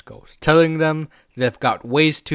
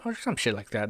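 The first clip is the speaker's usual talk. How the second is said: ordinary talk, nothing marked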